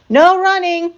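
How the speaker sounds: high, loud reminder; calling after. A narrator's reading. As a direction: call the rule after him down the hall. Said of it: "Miss Merriweather called after him".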